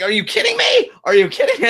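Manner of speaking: very high-pitched incredulous outburst; mock outraged yell. Host imitating angry wrestler post-match, mock outraged shriek.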